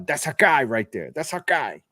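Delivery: put-on a light New York Italian accent; lightly done Italian-American accent; Nicky Cass Italian guy. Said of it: "a Nicky Cass, like a".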